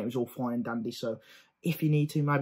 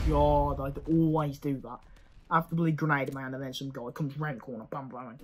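ordinary talk

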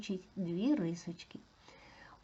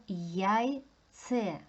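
ЯЙЦЕ slow, in two stretched syllables; careful, slow teacher demonstration; sounded out. Shown as a demonstration. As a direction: draw out both halves of ЯЙЦЕ. Teacher sounds out word for analysis.

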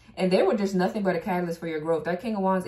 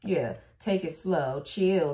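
ordinary talk, nothing marked

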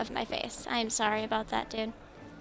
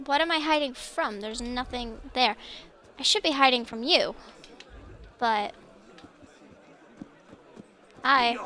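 normal talking voice

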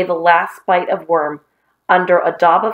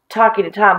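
plain reading voice

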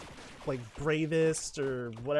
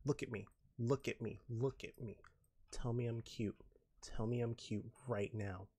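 low, soft, measured insistence; quiet mock menace; 3 semitones lower, 9 dB quieter than usual. Streamer pressing chat for compliments, quiet mock menace. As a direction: drop it down to a quiet order.

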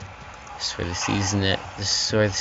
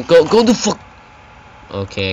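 usual speaking voice

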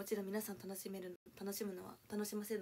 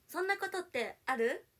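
higher, louder cutesy pouty line; fake cute voice; 8 semitones higher, 9 dB louder than usual. Shown as a demonstration. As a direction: push it up high and cute. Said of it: "高い、高い。".

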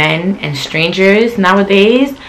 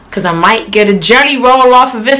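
ordinary delivery

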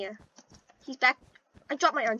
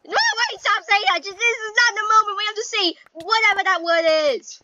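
higher and louder, rushed; frantic loud plea; 6 semitones higher, 5 dB louder than usual. Real, not acted. Gamer yelling at a teammate to stop the joke, frantic. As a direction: beg them to stop, half laughing.

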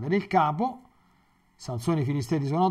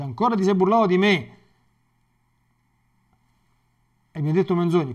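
higher and louder, a furious accusation; angry reproach; 5 semitones higher, 8 dB louder than usual. Acted. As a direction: snap at him for lying again. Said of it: "Dalila s'arrabbia".

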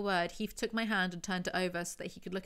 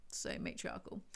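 whispered sarcasm; 10 semitones lower, 9 dB quieter than usual. Real, not acted. Sarcastic aside.

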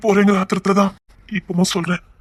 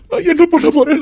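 higher pitched panic, stretching என்ன; panicked wail; 6 semitones higher than usual. Acted. Frantic self-questioning in panic.

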